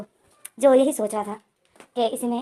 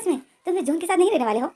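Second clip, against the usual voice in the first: higher pitched, eager and pleased; happy eager question; 7 semitones higher than usual. Acted. Jain eagerly checks Ivo will leave John, higher and delighted. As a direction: press for the good news gleefully. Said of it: "Jain खुश हो जाता है".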